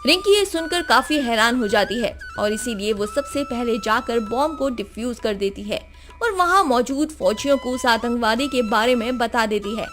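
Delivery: ordinary delivery, nothing marked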